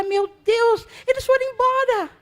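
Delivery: joyful release, a bit lighter; relieved outcry. Retold or imitated. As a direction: exclaim the relief again. Woman's relief retold again.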